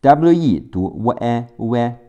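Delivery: usual speaking voice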